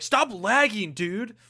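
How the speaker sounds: loud, high-pitched mock yell. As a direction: yell it like a fed-up gamer at teammates. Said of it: "You're just yelling at them like".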